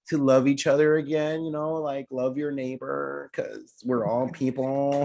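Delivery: sweet pageant contestant voice, a touch high; poised pageant answer. Acted. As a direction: answer like a beauty queen on stage. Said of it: "this is not Miss America".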